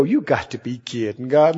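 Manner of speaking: low, quiet scoffing aside; a hint of an unbelieving old man; lightly voiced doubter; mock doubting Abraham.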